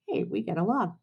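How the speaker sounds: ordinary delivery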